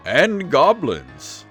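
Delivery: usual speaking voice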